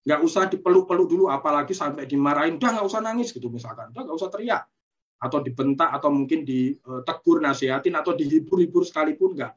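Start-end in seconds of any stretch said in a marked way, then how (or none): 2.6-3.3 higher-pitched imitation of a scolding parent; mock parental scolding. Parent telling a child to stop crying, mock scolding. 4.0-4.7 high-pitched mock reprimand, without raising volume; mock parental scolding. Parent telling a child to stop yelling, mock scolding.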